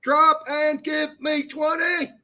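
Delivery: slow, high, shouted sergeant order; drill sergeant shout. Acted. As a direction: yell it at a recruit. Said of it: "drill instructor".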